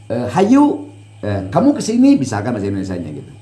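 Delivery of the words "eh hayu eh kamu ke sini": high, loud friendly Sundanese call; Cianjur town Sundanese. Shown as a demonstration. Teacher demonstrating the Cianjur town accent, calling someone over in Sundanese. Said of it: "Ketika saya bilang".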